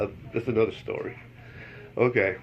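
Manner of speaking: ordinary delivery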